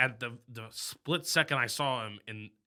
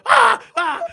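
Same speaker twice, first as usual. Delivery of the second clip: high, loud panicked shriek; retold terrified scream; 16 dB louder than usual. Telling a friend how he screamed at the intruder, high loud shriek. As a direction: shriek the way you did when you bolted the door. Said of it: "and go".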